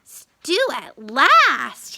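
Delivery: very slow, loud joyful cry; drawn-out happy exclamation; rejoicing. A narrator's reading. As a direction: savour it like a long-awaited feast. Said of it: "he rejoiced".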